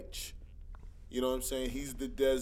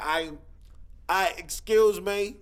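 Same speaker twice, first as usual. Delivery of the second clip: louder, higher mock customer complaining; A one Grit character; 6 semitones higher, 8 dB louder than usual. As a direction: play the put-out customer, loud and high. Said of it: "he'd be like".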